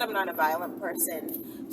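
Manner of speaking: ordinary talk, nothing marked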